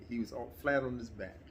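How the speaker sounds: ordinary talk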